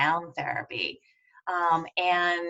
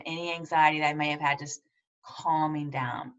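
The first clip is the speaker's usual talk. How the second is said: ordinary delivery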